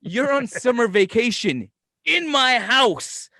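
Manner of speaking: loud, high, hammed-up protest; exasperated rant. Uncle complaining to his vacationing nephew, exasperated. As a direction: wail the complaint, laughter creeping in.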